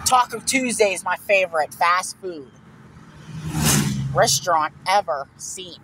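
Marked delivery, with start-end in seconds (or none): none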